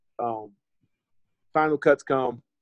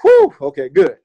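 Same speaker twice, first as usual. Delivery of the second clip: big relief; 3 semitones higher, 14 dB louder than usual. Retold or imitated. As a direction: let the relief burst out. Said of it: "you're like".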